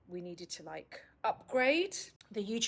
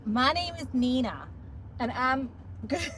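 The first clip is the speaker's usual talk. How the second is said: overdone Texan-style American, louder and higher; hammed-up American TV host; bad American accent; 4 semitones higher, 4 dB louder than usual. Shown as a demonstration. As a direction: try American, half Texas twang. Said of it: "I sound like a cross between".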